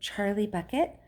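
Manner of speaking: a touch of nervousness; mildly timid, questioning; small nervous voice. A narrator's reading. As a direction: give the name timidly, as a question. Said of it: "nervous voice whispered".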